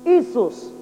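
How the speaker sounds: "Isus" said carefully as EE-soos; Greek name demo. Shown as a demonstration. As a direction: sound out "Isus" as the Greek form. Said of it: "Let's do it like this".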